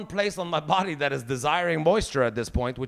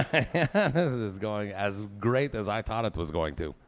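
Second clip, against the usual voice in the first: laughing; 5 semitones lower, 5 dB quieter, slower than usual. Real, not acted. Bombing joke, laughing at himself.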